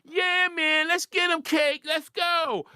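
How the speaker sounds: mocking hyped fans. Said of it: "Everybody like".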